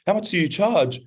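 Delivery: put-on high excited girlish voice; a thirteen-year-old girl gushing. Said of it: "And she goes".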